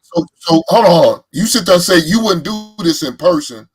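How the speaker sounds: loud, insistent hold on; heated pushback. Real, not acted. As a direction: shout him down to make the point. Heated debate, pressing his point.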